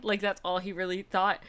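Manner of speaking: ordinary talk, nothing marked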